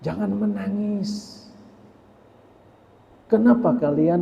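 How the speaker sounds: a calm dying master comforting mourners; dying sufi master. Acted. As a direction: gently tell the weeping crowd to rejoice instead. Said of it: "apa ajar Imam Syekh Jalaluddin".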